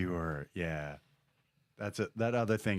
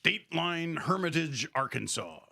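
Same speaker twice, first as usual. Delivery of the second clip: news read; 5 semitones higher than usual. Acted. Reads news segment.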